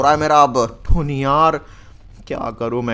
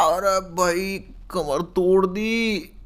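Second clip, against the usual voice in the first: ordinary talk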